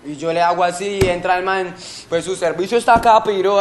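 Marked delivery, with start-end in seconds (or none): 2.1-3.6 loud, raised robber's threat; retold threat. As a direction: act out a holdup threat, loudly. Imita la amenaza del atracador. (amenaza imitada, fuerte y aguda)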